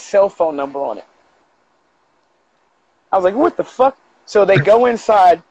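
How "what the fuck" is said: higher, disbelieving what-the; retold incredulous outburst. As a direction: react in baffled disbelief. Retold shock at the card.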